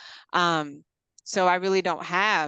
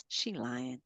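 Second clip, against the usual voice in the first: knowing look; 7 semitones lower, 12 dB quieter than usual. Retold or imitated. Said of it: "Be looking at you like".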